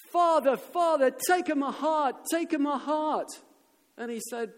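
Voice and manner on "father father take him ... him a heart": high, loud, drawn-out chanting yell; crowd shouting. Acted. Crowd in the square offers the Pope their hearts, shouting. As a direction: shout it like a whole pleading crowd. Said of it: "the people as one shouted".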